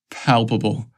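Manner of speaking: usual speaking voice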